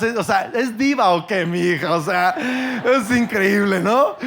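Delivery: proud delight. Real, not acted. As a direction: gush over the photo like a proud dad. Proud father shows daughter's photo. (padre orgulloso, emocionado y en voz alta)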